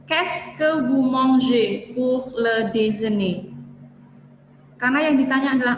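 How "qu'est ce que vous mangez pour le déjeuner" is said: slow, careful "Qu'est-ce que" question; slow model question. Shown as a demonstration. Teacher models a what-question for students, slow. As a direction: drag each word out for learners. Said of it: "Apa yang kamu makan".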